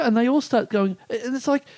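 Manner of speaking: ordinary delivery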